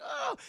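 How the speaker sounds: very high-pitched yelp; imitated cry of a victim; mock pained cry. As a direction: yelp as if stabbed, played for effect.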